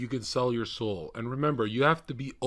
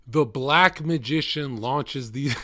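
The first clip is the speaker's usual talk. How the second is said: laughing while reading; 4 semitones higher, 6 dB louder, slower than usual. Real, not acted.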